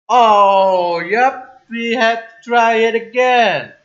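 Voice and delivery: loud dismay. Real, not acted. Player learning they must try it again, loud exasperation. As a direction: groan it out loudly in dismay.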